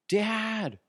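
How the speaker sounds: high calling voice of a son; child calling. Retold or imitated. Son calling his dad at night, a high call. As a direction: call out for dad from far away.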